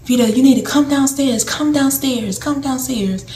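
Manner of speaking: high calls, fading each time; family calling up. Retold or imitated. Family calling Vita downstairs from her room, high calling. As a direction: call her down from the stairs.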